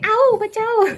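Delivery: high, loud imitated scream for rescue; hammed-up call for help; mock cry for help. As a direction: yelp it like someone panicking, played for laughs. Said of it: "यहाँ पे बुसरा कर रही है".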